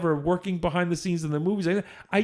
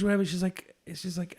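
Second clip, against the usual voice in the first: ordinary talk, nothing marked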